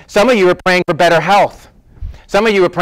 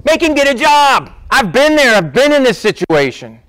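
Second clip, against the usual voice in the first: mocking knucklehead; 6 semitones higher than usual. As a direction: sneer like a pushy stranger butting in. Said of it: "some other knucklehead... go".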